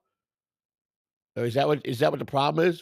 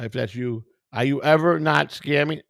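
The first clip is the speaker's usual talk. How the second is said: usual speaking voice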